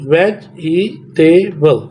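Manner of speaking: वेजिटेबल in four slow beats, vej-i-te-bal; slow, segmented वेजिटेबल; syllable by syllable. Shown as a demonstration. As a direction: separate every syllable of वेजिटेबल. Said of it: "इसके four बनते हैं".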